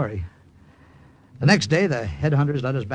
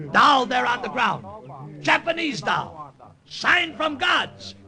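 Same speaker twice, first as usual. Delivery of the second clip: higher, slow, simple broken-English proclamation; pidgin pitch to natives; 8 semitones higher than usual. Acted. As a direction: preach the omen to the tribe. Preaching omen to the tribe.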